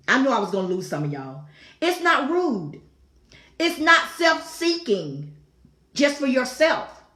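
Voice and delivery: usual speaking voice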